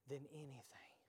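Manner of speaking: whispered hymn line. Acted. Preacher quoting the old church song, whispered. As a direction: let it fade to a whisper.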